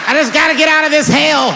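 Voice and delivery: high anguished cry; desperate shout. Acted. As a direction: yell to escape.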